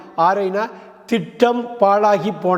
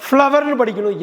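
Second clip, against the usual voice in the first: ஃப்ளவர்னு said higher and stressed; emphatic correct word; 5 semitones higher than usual. Shown as a demonstration. Teacher insisting to viewers on the right reading, stressed. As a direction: stress ஃப்ளவர்னு with a lift in pitch. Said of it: "எப்படி படிக்கணும்னா".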